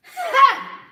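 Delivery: very loud "Ha!" driven from deep in the belly; diaphragm yell. Shown as a demonstration. Teacher showing the right yell from the diaphragm. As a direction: push "Ha!" up from the diaphragm. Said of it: "So you wanna yell from here, from deep in that powerful place".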